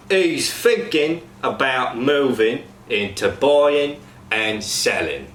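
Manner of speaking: slow Cockney with "thinking", "buying" ending in n; slow example in Cockney; Cockney ing to n. Shown as a demonstration. Coach demos ing to n to cast. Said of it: "Example sentence".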